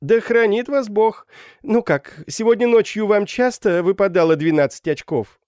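high, loud mocking hails; jeering students. A narrator's reading. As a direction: heckle a dignitary from the window. (насмешливые выкрики школяров)

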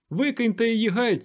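very high, loud command; fervent high-pitched cry; impassioned shout. A narrator's reading. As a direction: shout urgently to the crowd. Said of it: "заволав дикун".

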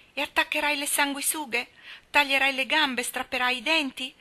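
put-on a girl shuddering at the thought; trembling fear. A narrator's reading. Frightened girl questioning her friend about surgery, mildly scared. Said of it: "che tremava solo a pensarci".

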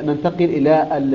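ordinary delivery

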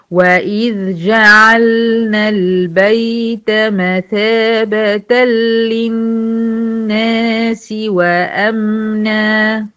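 slow, drawn-out melodic tajweed chant; Quran recitation. Acted. Teacher reciting the verse to her students, slow melodic chant. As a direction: chant each verse slowly with long held vowels.

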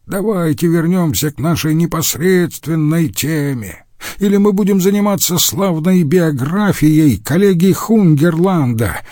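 high-pitched, indignant interruption; exasperated heckle from a colleague; angry outburst. A narrator's reading. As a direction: cut in crossly, voice pitched well up. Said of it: "раздался злой окрик".

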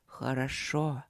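soft, low voice; drawn-out hushed approval; quiet low approval. Acted. Mystical voice approves again.

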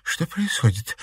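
small, scared asking voice; frightened question. A narrator's reading. Girl stumbling on the chaos, frightened question. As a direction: ask it in a touch of fright. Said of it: "испуганно спросила Марина".

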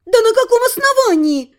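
high-pitched outraged question; indignant cry. A narrator's reading. Heroine challenges the count's detention, crying out indignantly. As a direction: let indignation push the pitch right up. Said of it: "сорвалась на крик".

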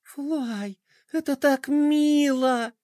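slow, high touched gasp; delighted gasp. Acted. Princess touched by the poem, delighted gasp. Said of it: "ахнула Пинки".

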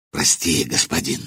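guilty commander apologizes, soft and low; ashamed apology. Acted. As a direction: mumble it in shame. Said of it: "опустив глаза, проговорил Кулиба".

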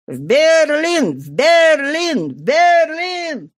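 slow, drawn-out chant from the street; crowd shout. A narrator's reading. Crowd on the boulevard chanting, slow distant shouts. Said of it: "На бульваре раздался крик".

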